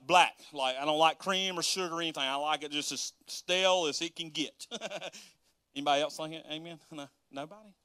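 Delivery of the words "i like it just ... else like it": ordinary delivery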